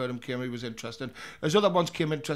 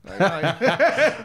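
laughing; 4 semitones higher, 9 dB louder than usual. Real, not acted. Geordie replies laughing.

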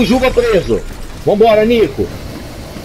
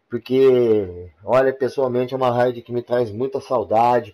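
softer, relaxed channel host read; YouTuber intro; 7 semitones lower, 7 dB quieter than usual. Acted. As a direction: talk to your subscribers like a host. YouTuber intro and outro to viewers. (apresentador de canal, tom descontraído)

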